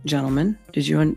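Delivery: normal talking voice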